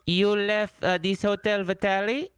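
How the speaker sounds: high put-on voice of a polite hotel clerk; disguised caller voice. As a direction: pitch it up like a fussy hotel worker. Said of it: "such a good job disguising it".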